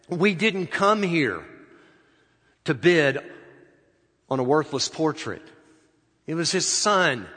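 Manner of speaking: loud, indignant shout from the back of the room; angry heckler. Acted. A bidder yelling at the auctioneer, loud and outraged. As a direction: bark it out like a cheated collector who wants the real art.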